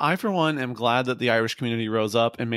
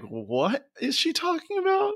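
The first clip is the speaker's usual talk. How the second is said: high baffled voice. Retold or imitated. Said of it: "they're like".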